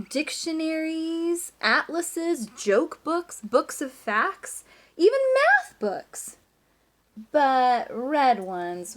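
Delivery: slow, list-like read-aloud with a big jump on math books; reading to children. A narrator's reading. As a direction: savour every item for the class.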